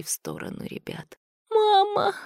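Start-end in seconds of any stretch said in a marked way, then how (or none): 1.5-2.1 very high, scared cry; frightened exhalation. A narrator's reading. Girl sees creatures crawling toward her, frightened exhalation. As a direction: breathe it out high in terror. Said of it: "выдохнула Света".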